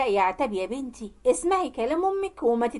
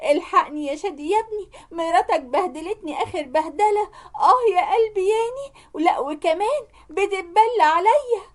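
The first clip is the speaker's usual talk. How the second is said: high, hammed-up tearful whine; theatrical victim's wail, pitched up; fake crying; 6 semitones higher than usual. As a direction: pretend to sob while pleading for sympathy. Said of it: "عملت نفسها بتعيط وقالت".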